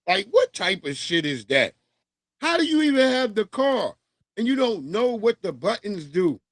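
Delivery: ordinary talk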